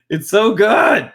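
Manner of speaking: slow, loud, high shout through laughter; delighted shout. Real, not acted. Fan raving to a friend about a manga, slow delighted shout. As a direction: belt out how good it is.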